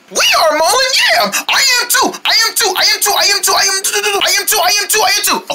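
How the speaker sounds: high excited chanting; excited shouting. Acted. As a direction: cheer it over and over, high.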